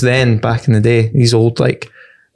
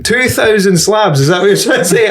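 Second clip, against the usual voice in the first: mock disbelief; 5 semitones higher, slower than usual. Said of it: "Oh, I went".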